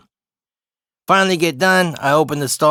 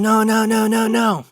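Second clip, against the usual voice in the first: imitated screaming protest; 7 semitones higher than usual. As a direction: mimic a child's shrieking refusal, high-pitched. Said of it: "So he's screaming and shouting".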